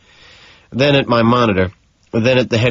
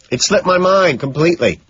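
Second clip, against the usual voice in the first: higher, called across the street; calling back; 8 semitones higher than usual. A narrator's reading. Patrick calling back to Jean at the door, raised voice. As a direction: toss it back casually over distance.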